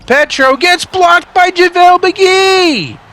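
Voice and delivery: high, loud hyped call, slowing on the block; excited shout. Acted. Gamer hyping the go-ahead play, shouting play-by-play. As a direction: yell it like the arena just erupted. Clutch moment in the game.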